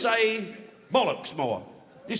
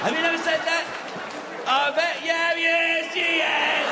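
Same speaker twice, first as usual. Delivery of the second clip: higher and louder, goading a woman in the crowd; playful louder jeer at an audience member; teasing shout; 10 semitones higher, 4 dB louder than usual. Acted. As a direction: egg her on, rising to a shout.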